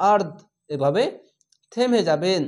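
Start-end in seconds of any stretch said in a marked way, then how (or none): 0.1-0.3 আর্দ ended short on silent দ; pausal form. Shown as a demonstration. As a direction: stop on আর্দ with no vowel. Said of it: "থামতে গেলে শেষ অক্ষর সাকিন দিয়ে". (আর্দ থেমে পড়া)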